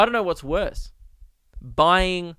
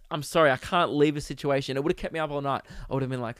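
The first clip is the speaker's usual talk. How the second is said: normal talking voice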